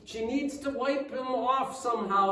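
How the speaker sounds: normal talking voice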